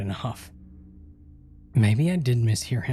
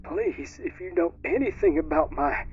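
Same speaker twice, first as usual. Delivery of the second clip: higher, sobbing public plea; halting sobs; 8 semitones higher than usual. Acted. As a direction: plead through tears. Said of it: "his voice returned to a halting sobs".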